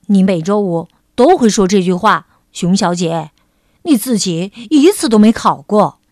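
displeased rabbit, low and loud; 不高兴. A narrator's reading. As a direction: scold a friend in a low, forceful voice. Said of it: "兔子不高兴地说". (低声用力地抱怨，很不高兴)

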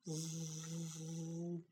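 soft, low, sustained zzz; sleeping buzz. A narrator's reading. Little bunny finally asleep, soft buzzing snooze. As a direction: hum a quiet snoozing sound. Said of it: "went little bunny".

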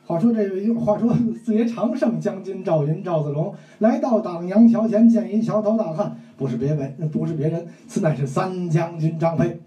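pingshu storyteller cadence; 评书腔; storytelling recital. Shown as a demonstration. Storyteller recites Changban Bridge.

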